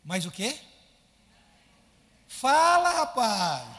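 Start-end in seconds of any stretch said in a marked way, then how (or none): 2.3-3.7 loud high shout at the crowd; sudden loud challenge to the crowd; calling out. Real, not acted. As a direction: call them out to answer.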